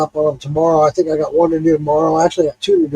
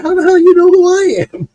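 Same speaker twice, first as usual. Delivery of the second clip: high, loud amazed cry; retelling the shock of being recognized; astonished; 11 semitones higher, 5 dB louder than usual. Retold or imitated. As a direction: blurt it out in disbelief. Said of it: "I was like".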